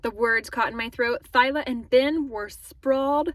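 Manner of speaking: plain reading voice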